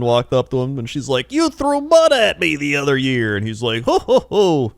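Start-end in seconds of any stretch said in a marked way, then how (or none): 1.3-3.3 teasing woman. Retold or imitated. Woman teasing mud performer. 3.8-4.7 high, loud mock jolly ho ho; hammy performer chuckle. Mud performer answering the teasing woman with a jolly ho ho.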